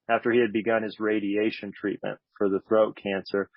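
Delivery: ordinary talk, nothing marked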